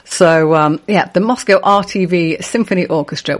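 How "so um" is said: usual speaking voice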